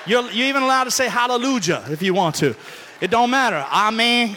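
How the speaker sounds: normal talking voice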